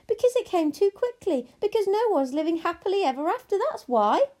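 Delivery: high, loud, hurried argument; indignant child. Acted. Abilene explains why it can't end, indignant. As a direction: argue hotly in a child's high voice. Child argues about ending.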